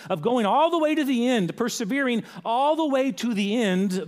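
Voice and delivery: high, loud stress on "all the way to the end"; emphatic urging. Real, not acted. Preacher urges the church to persevere to the end, emphatic. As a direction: drive home "all the way to the end".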